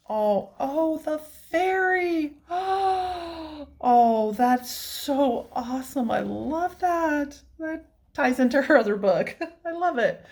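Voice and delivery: high, slow delighted gasping; overjoyed squealing delight; thrilled. Real, not acted. Thrilled at last haul item.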